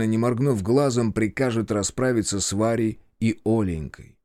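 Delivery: usual speaking voice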